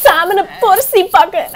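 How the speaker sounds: playful high-voiced echo; high put-on voice. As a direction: say it again in a squeaky character voice. Repeats action in put-on voice.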